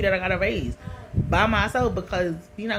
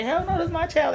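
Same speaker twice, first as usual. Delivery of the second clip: higher-pitched mock outrage, retelling it; indignant refusal; 11 semitones higher than usual. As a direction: protest it as if replaying the moment. Refusing to give up son.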